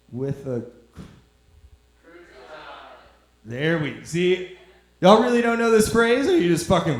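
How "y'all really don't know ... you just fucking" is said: loud, high disbelief; incredulous shout. Real, not acted. Incredulous at silent audience.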